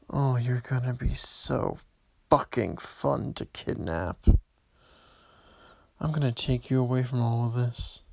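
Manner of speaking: normal talking voice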